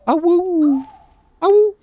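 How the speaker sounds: high playful puppy howl; howling like a wolf pup. Pretend. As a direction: yip a high little awoo. Howling at the in-game pups.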